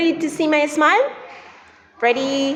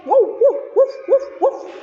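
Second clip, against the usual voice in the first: barking dog; 10 semitones higher than usual. Pretend. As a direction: bark like a happy puppy for kids. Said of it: "I'm a dog".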